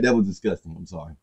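quietly stunned by the bar; muted awe. Real, not acted. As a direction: say it under your breath. Muttered after rewinding.

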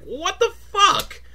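high, slow stunned squeal; shocked disbelief. Retold or imitated. Learning Don Coyote was Don Quixote, stunned high squeal. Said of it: "I was like".

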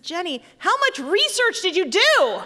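high, loud mock outcry; hammed-up motherly shriek of dismay; scandalized mother. Mother reacts to daughter's racy title, scandalized.

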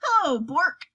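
light mock delight; girl hailing the troll Bork; cheerful greeting.

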